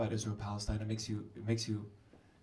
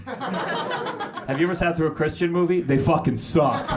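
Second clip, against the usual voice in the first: normal talking voice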